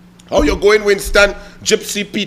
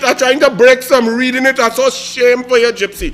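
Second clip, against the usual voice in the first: usual speaking voice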